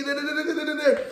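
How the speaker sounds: high, soft nonsense rant syllables; mimicking mom's rant. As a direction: wave off her tirade in a high da-da-da. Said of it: "she all going off".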